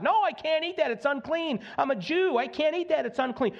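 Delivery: high, indignant refusal; protesting Peter. Retold or imitated. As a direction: plead that it's unclean.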